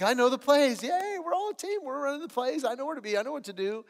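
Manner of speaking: high, giddy overconfident chant; mock team cheer. Team boasting after practice, high mock giddy. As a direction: sound naively proud of knowing it all. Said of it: "and we're like".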